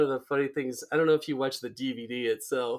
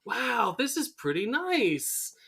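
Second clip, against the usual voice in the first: high, slow mock admiration; impressed Burns; 9 semitones higher, slower than usual. Burns admiring the child's model, impressed. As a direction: draw it out, pleasantly impressed. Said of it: "Burns is like".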